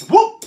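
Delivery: very high, loud whoop; startled whoop. Real, not acted. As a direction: yelp suddenly at top pitch. Reacts to slip with wand.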